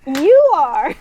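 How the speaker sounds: high, loud retort; childish angry comeback. Acted. Zephyr answering Luffy's insult, loud angry comeback. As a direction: throw the insult right back. Rivals' acted quarrel.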